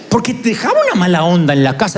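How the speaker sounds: normal talking voice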